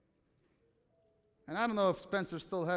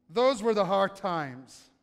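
louder, driving sermon read of Hebrews; preaching a scripture reading; 3 semitones higher, 5 dB louder, slower than usual. Acted. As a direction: preach the passage with conviction, slowing on key lines. Reads Hebrews passage.

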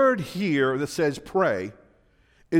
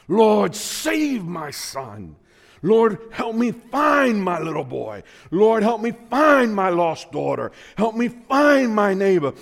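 desperate pleading prayer; 5 semitones higher, 5 dB louder, slower than usual. Acted. Voicing desperate parent's prayer.

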